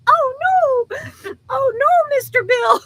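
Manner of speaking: high, loud wailing "oh no"; Mr. Bill impression. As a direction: wail it like the clay figure.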